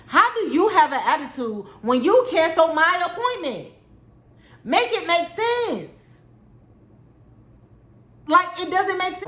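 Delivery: indignant, can't believe this; high incredulous rant; exasperated. Real, not acted. Venting about the cancellation.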